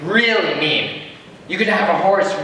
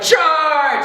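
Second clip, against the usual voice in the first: shouted battle cry, much higher and louder; general's charge; 11 semitones higher, 4 dB louder than usual. Retold or imitated. General orders his troops forward, shouting high and loud. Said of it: "the general's like".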